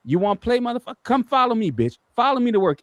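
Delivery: high retold taunt at the tailgater; taunting challenge. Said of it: "So I'm like".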